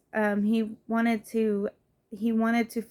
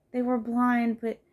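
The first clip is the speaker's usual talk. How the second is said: ordinary talk, nothing marked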